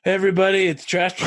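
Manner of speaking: big shouted hello; loud greeting. Real, not acted. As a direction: call it out loud like a show host. Loud self-introduction.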